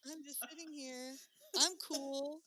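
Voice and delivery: sing-song cutesy self-mockery; mock cute mermaid. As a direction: play the cute mermaid hoping for friends.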